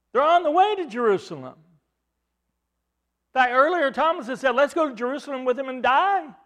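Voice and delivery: fervent preacher in full flow; preaching. Acted. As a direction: drive the point home to the congregation. Preacher builds to full force.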